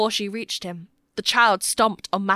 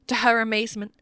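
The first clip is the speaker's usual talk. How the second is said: usual speaking voice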